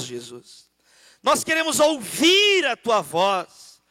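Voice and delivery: loud, high, slowed preaching climax; full-force preaching. Acted. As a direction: stretch out each phrase to the church. (pregação inflamada, alta e lenta)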